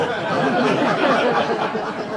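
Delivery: long loud high laughter. Real, not acted. Laughing hard at the French dog joke.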